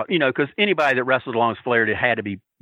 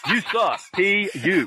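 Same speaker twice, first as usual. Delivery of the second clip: higher taunt broken by laughter; laughing mock jeer; 6 semitones higher, slower than usual. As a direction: jeer while cracking up. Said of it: "put the sign over him like".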